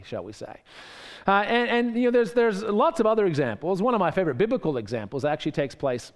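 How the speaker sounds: ordinary delivery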